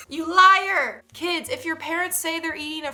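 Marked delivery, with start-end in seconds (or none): 0.1-1.0 loud whiny accusation; kid voice. Retold or imitated. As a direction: wail it like a betrayed kid.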